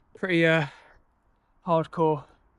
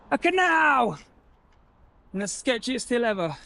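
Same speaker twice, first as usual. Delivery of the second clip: triumphant discovery shout; excited; 7 semitones higher than usual. Real, not acted. Finally finds the canal after getting lost.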